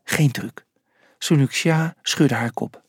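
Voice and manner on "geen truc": low, hushed assurance; secretive low whisper; whispered reply. A narrator's reading. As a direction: whisper it back briefly. Whispered exchange continues.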